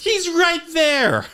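high, loud, drawn-out exclamation; exasperated high-pitched shout; outraged yell. Real, not acted. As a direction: yell it in comic disbelief. Outraged interjection about the ignored dad.

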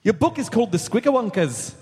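a Scottish attempt that slides into Irish brogue; "Your book is called The Squicker Wonkers" in a clumsy mock Irish lilt; accidental Irish accent. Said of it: "Nope, that's Irish".

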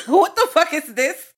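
slow, high, loud indignation; escalated outrage. Acted. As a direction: raise the pitch in incredulous outrage. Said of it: "leaving a one-star Yelp".

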